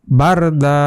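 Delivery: বারদা with the a of বার held one beat; one alif stretch. Shown as a demonstration. Teacher showing how to read বারদা with one alif. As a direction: hold the first a in বারদা. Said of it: "এখানে এক আলিফ টান".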